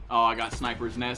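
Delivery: ordinary talk, nothing marked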